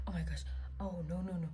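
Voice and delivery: hushed, lightly panicked oh no; mock a frantic hushed oh no; whispered panic. Pacing with her friend, a light whispered panic.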